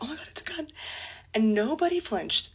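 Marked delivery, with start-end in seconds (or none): 0.0-0.7 high, hushed panicked gasp; mock panic. Retelling confetti mistaken for a gunshot, mock panic. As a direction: duck and blurt it in fright. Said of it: "I ducked and I was like".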